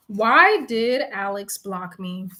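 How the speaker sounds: usual speaking voice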